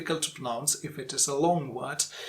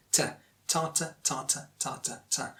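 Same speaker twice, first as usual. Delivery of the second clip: ta, ta alternating strong and weak, softer as it goes; stress-timed rhythm; 5 dB quieter than usual. Shown as a demonstration. Teacher repeats the rhythm demo, alternating stressed and unstressed ta. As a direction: chant TA-tuh, TA-tuh, dropping away. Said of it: "Ta the stressed and ta the unstressed".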